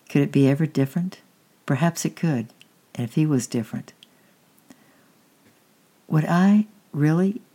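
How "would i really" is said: wondering question. A narrator's reading. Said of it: "he asked".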